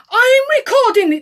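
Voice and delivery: loud, high-pitched mock announcement; mimicking arrogant man. Mimicking the driver announcing he is recording her, arrogant. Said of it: "This is how he spoke".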